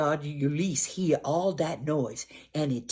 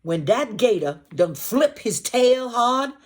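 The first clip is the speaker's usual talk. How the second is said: ordinary delivery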